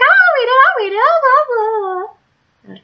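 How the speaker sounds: very high, loud, eager mock voice; pushy squeal. Imitating Sophia begging to read the book, squeaky. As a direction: parody her grabbing for the book, squeaky high. Friend insisting on reading.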